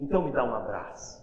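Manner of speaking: put-on soft and low, a father consoling his crying child; gentle father. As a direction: hush the voice down as a loving dad. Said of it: "Aí o pai diz assim".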